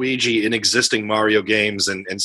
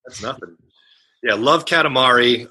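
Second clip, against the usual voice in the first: ordinary talk, nothing marked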